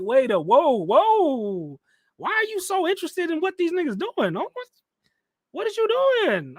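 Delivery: high-pitched mock protest from a husband; alarmed husband. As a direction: act the husband, pitch leaping up in disbelief. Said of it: "if I was her husband, I'd be like".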